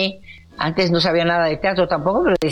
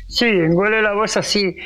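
imitating an engolado voice; 3 semitones higher than usual. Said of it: "pero engole la voz".

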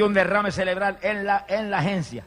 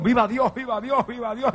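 high-pitched reenacted cry of praise; mock full-voice exclamations, pitched up; retold ecstatic shout; 8 semitones higher, slower than usual. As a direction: chant it high, imitating an uncontrollable shout. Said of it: "comencé a gritar a toda voz".

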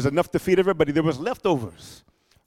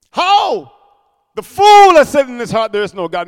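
high, loud cry of how, then the verse hammered out; preaching climax; 3 semitones higher, 10 dB louder than usual. Acted. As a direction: build to a peak before the congregation. Preacher's climax quoting scripture.

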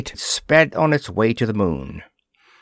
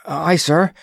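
shaky breath; 6 semitones higher than usual. A narrator's reading. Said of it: "breathed Jorgens shakily".

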